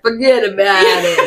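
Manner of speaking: mock Long Island forget about it; imitating a Long Island New Yorker; New York accent. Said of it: "people from Long Island".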